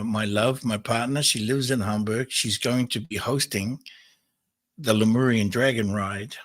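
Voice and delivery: ordinary talk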